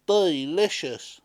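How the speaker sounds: slow, loud savouring cry; villager crying out. A narrator's reading. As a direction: stretch it out with delight. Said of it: "cried out another villager".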